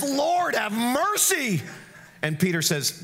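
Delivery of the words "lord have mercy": much higher, slow, pleading cry; crying out for mercy. Retold or imitated. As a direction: plead to the Lord with everything you have. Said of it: "the guy cries out and says".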